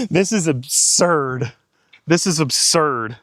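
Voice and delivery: slow, disbelieving and thrilled; drawn-out, overwhelmed excitement; freaking out. Real, not acted. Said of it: "I am freaking out".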